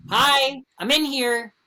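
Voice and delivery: high, loud call from the stall; high startled call. Retold or imitated. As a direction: call out to warn the intruder. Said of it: "I'm like".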